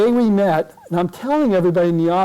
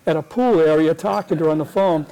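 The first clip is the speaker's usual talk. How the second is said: ordinary talk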